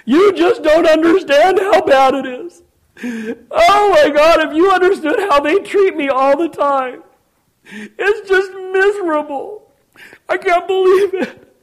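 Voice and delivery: high, loud, drawn-out wail; hammed-up self-pitying whine at full volume; mock forlorn complainer. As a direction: wail miserably, stretching the words in mockery. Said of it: "to be cast down and forlorn".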